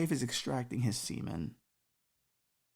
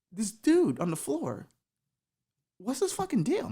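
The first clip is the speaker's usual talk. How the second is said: alarmed Jesus; 9 semitones higher than usual. Acted.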